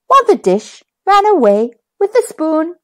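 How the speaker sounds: plain reading voice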